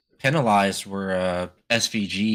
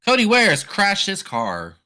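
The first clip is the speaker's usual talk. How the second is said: higher, louder and slow, announcing the crash; excited; 9 semitones higher, 5 dB louder, slower than usual. Real, not acted. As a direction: call it out like breaking news. Reacting live to a crash.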